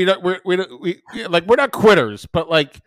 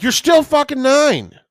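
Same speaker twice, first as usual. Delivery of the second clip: exasperated; 11 semitones higher, 6 dB louder, slower than usual. Real, not acted. Exasperated aside to listener.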